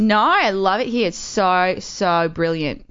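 slow, stretched Aussie vowels; fake Australian accent. Shown as a demonstration. Pretend Australian chatting up people in a club, drawn-out accent. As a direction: gush slowly in a put-on Australian accent. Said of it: "I'll go in a club and I'll be like".

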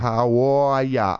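slow, drawn-out Boston greeting; a thick Boston "How are ya"; Boston accent. Retold or imitated. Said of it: "other Boston people".

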